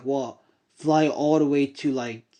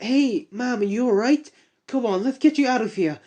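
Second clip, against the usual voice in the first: higher, gentle reassurance; lightly voiced reassuring heroine; 7 semitones higher than usual. Young heroine reassures the fallen woman, a lightly higher kind voice. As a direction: comfort her like a kind rescuer.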